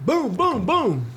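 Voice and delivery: high gloating booms; cartoon victory chant; mock triumph. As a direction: gloat like you just won. Celebrating beating kids.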